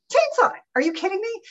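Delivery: incredulous high-pitched outburst; Chainsaw! squeaked up high in disbelief; sudden astonished cry. Retold or imitated. Horse spooked by chainsaw.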